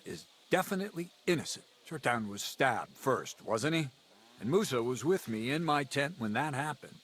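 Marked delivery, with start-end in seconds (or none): none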